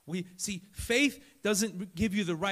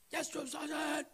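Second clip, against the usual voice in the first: crisp recruit answer, pitched up; barked military recruit reply; 9 semitones higher, 3 dB quieter than usual. Retold or imitated. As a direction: snap back like a recruit in basic training. Recruit answering drill sergeant.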